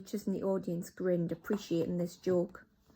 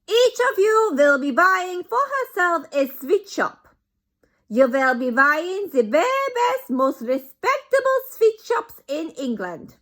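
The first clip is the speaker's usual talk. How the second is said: put-on much higher, loud accented orders; Grand High Witch; 11 semitones higher, 12 dB louder than usual. Grand High Witch explains the plan, loud accented. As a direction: instruct grandly.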